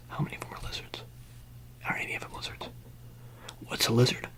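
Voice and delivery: very soft, near-whispered questions; hushed roleplay questioning. Pretend. Talking to someone about lizards among them, hushed questioning.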